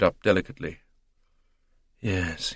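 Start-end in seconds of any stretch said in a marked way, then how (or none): 2.0-2.5 soft, low murmur; quiet, low-pitched assent. A narrator's reading. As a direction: murmur it thoughtfully to yourself. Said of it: "he murmured".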